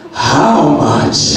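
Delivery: exaggerated sarcastic imitation. Fiancée answering a love declaration, mock sarcastic. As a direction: mimic her dripping-with-sarcasm retort. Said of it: "in the most sarcastic voice ever".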